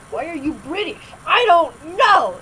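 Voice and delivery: loud, high mock wail; acted panicked yelling. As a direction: yell it like a delirious late-night gamer, hammed-up. Reenacts late-night gaming yelling.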